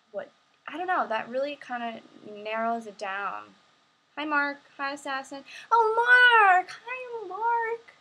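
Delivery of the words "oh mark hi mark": high, happy squeal of the name; light up at seeing a friend; excited greeting. Real, not acted. Streamer greeting her friend Mark in a high squeal.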